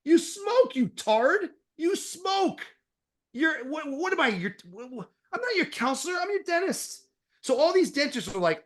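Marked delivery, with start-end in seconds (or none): 0.1-7.1 much higher, mock incredulous tirade; exasperated outburst. Dentist imitating himself scolding a smoking patient, exasperated and high. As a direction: throw it back at the patient in disbelief. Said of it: "I go".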